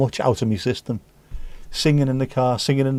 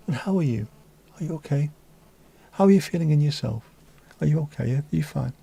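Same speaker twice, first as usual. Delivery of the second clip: gentle therapist; 2 semitones higher, 4 dB quieter than usual. Acted. Mock therapist questions.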